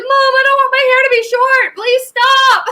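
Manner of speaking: high, loud, whining plea; panicked whine. Retold or imitated. Daughter begging mom to stop cutting, high panicked whine. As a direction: squeal it like a kid begging. Pleading with mom mid-haircut.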